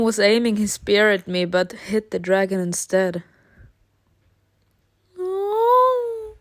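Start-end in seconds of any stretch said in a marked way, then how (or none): 5.2-6.3 high distressed no. Real, not acted. Dragon wounded.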